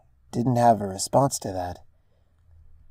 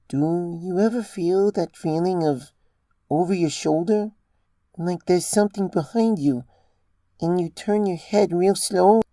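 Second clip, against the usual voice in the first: higher, earnest mare voice explaining strange things; Ditzy's voice; 7 semitones higher than usual. A narrator's reading. Ditzy confides in Rose about hidden creatures, earnest and halting.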